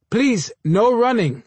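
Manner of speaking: high, slow yell of a guard. A narrator's reading. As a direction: call it out, stretching the words. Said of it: "the security guard yelled".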